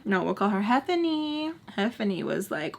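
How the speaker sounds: usual speaking voice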